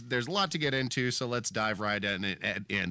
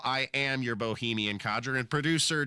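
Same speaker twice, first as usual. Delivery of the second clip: usual speaking voice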